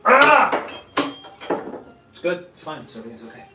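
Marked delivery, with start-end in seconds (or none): 0.1-0.6 sharp high cry of alarm; startled yelp. Real, not acted. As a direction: yelp as if something slipped. Sudden cry while cooking.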